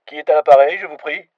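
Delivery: a flustered desk clerk answering; receptionist. A narrator's reading. Receptionist hesitantly answering an unexpected foreign caller.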